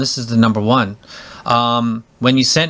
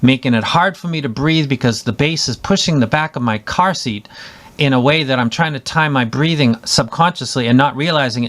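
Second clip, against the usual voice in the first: ordinary delivery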